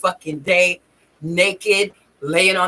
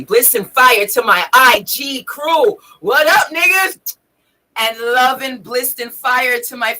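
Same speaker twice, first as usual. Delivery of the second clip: hearty shout-out; 3 semitones higher, 4 dB louder than usual. Real, not acted. Greeting the IG crew on a livestream, hearty shout-out. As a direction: call out to the crew.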